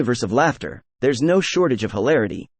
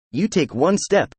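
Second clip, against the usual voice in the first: ordinary talk, nothing marked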